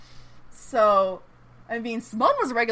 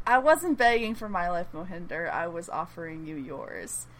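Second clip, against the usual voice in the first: low, soft letdown, sinking as it goes; retold disappointment; 5 semitones lower, 6 dB quieter than usual. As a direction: let it drop quietly, disappointed in them. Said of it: "he's disappointed".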